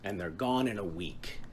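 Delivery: complaining. Retold or imitated. Poet quoting their own grumble to a companion, complaining. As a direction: voice a grievance, loud at first then easing. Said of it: "I complained".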